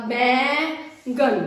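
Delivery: slow बैंगन with held nasal; teacher drawing out बैंगन; drawn-out nasal. Shown as a demonstration. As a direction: stretch the nasal after ब. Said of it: "ब के बाद".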